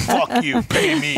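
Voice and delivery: imitating an indignant, stubborn friend; hammed-up defiance. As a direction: throw it out as a comic outburst. Imitating friend refusing favours.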